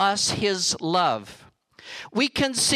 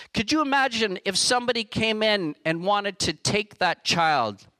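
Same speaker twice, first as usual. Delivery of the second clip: ordinary delivery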